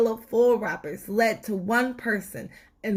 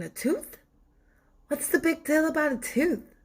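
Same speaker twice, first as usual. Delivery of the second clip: skeptical reader; 8 semitones higher than usual. Pretend. Imagined reader's skeptical question.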